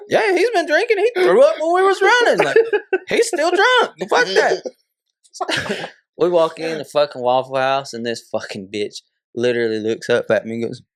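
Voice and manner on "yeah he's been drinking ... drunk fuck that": very high, laughing mock outburst; retold indignant outburst. Son tells mom on drunk friend, high indignant mock outburst. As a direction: blurt the blame out, pitched way up.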